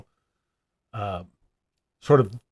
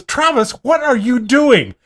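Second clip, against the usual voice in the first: higher, louder, drawn-out scolding; exasperated dad; 11 semitones higher, 5 dB louder than usual. Retold or imitated. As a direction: imitate a dad's loud exasperated scolding. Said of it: "Dad kind of pushes his hands away and goes".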